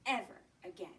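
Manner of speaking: plain reading voice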